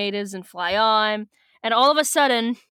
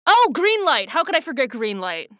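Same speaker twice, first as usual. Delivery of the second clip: sudden realisation; 3 semitones higher than usual. Real, not acted. Host remembering one more [name] song, surprised. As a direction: blurt it out as it comes back to you.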